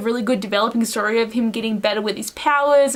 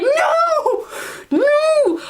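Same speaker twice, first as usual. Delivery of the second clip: mock horrified yell. Retold horror at betrayal.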